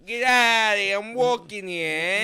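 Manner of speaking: loud, high New York street yell; New York accent. Pretend. Co-host playing a New Yorker after "welcome to New York", yelled accent. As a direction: shout it like an outraged New York pedestrian, hammed up. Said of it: "we all talk like that".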